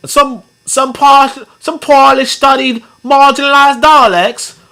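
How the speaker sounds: high, slow mock-Cockney accent; exaggerated English accent. Shown as a demonstration. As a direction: ham up a Cockney accent, pitched high and drawn out. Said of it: "they're actually the exaggerated English accent".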